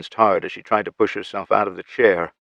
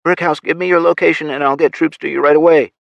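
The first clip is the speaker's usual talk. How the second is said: higher, louder, pressing demand; insistent; 8 semitones higher, 6 dB louder than usual. A narrator's reading. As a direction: push hard for an answer. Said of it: "the voice insisted".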